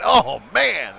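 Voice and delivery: normal talking voice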